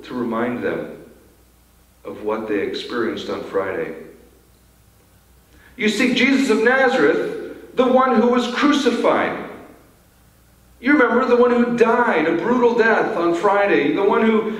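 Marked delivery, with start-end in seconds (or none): 5.8-12.7 higher, pointed reminder of the cross. Pretend. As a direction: press the reminder home, higher. Cruel reminder, slowed phrases.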